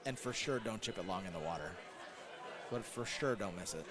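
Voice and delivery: low, soft inner voice. Retold or imitated. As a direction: talk him through it under his breath. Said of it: "right now in his head, he's like".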